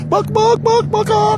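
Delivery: high mock clucking; jeering at a timid driver with chicken clucks.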